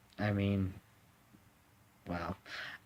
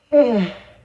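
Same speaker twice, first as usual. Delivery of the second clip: loud sigh; 12 semitones higher, 15 dB louder than usual. Real, not acted. Heavy sigh after sad remark.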